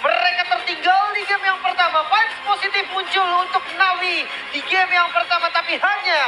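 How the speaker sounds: loud triumphant play-by-play; esports caster. Acted. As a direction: announce the reverse sweep. Calling the comeback win.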